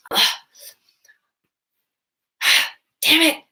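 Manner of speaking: exasperated sigh then a high, loud "damn it"; frustrated outburst. Retold or imitated. Partner imitating a frustrated outburst at home, sighing. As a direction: blow up in mock frustration over something small. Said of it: "Never just like".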